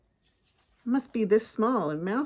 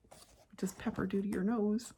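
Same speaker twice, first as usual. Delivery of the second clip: whispering; 6 dB quieter than usual. Real, not acted. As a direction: whisper it, building suspense. Whispered aside to child.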